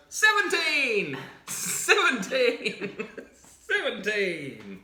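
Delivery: slow, high drawn-out shouts breaking into a laugh; gleeful kid yell. Acted. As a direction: savour each shout, cackling. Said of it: "hops up and goes".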